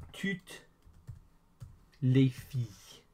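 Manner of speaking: slow, with a long gap after toutes; word by word. Shown as a demonstration. Teacher models French for all the girls, word by word. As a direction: break it up slowly for learners. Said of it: "all the girls is".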